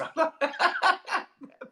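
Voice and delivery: high real giggling fit; helpless laugh. Real, not acted. Trying to justify liking Scousers, high helpless laugh. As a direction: burst into high laughter at yourself.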